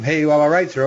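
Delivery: ordinary talk, nothing marked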